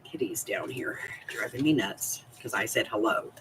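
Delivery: hushed whisper aside; soft unvoiced aside about the cat. Real, not acted. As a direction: whisper it so the cat won't hear. Aside while cat pesters her.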